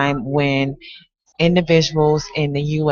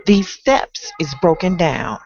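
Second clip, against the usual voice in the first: aggrieved tenant pleading with the landlord; complaining tenant. Acted. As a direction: recite as a fed-up tenant, lifting into indignation. Performs the tenant in a poem.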